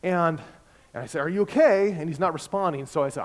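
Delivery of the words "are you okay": loud call to the driver. Retold or imitated. Pastor calling to an unresponsive drunk driver, loud. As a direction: raise your voice and ask again.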